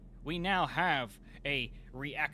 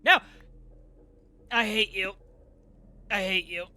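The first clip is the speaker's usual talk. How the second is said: loud, higher mock-angry outburst at the machine; frustrated yell; 6 semitones higher, 12 dB louder than usual. Real, not acted. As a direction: yell at the broken gadget. Machine fails again.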